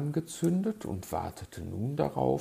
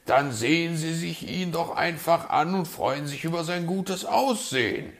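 loud, raised retort; mildly testy, higher voice; exasperated advice; 9 semitones higher, 8 dB louder than usual. A narrator's reading. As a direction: push back impatiently as the fox. Fox exasperated with magpie.

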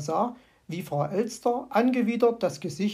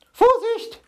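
very high, loud warning cry; panicked shout; alarmed scream; 9 dB louder than usual. A narrator's reading. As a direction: shriek a sudden warning. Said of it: "schrie sie".

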